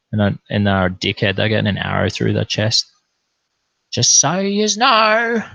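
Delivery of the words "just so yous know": high, loud, slow warning; pointed warning. Real, not acted. Warning his online critics, slow, high and loud. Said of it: "warning after threat".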